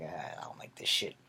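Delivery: low, whispered disgust. Retold or imitated. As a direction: mutter it, a little sick. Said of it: "getting a little bit sick".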